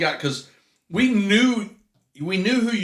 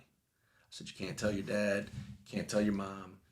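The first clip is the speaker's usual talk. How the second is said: put-on softer, hushed, conspiratorial voice to a small kid; hushed secret; 6 semitones lower, 15 dB quieter than usual. Man confiding a secret to his boss's little son, hushed. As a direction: let him in on a big secret, softly.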